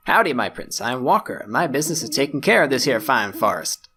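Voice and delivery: a light Texan twang for Walker the ranger; forest ranger's Southern drawl. Acted. Walker the forest ranger greeting the prince, light Southern accent. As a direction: lean into a folksy Southern accent, played lightly. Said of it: "Accents are a weakness of mine".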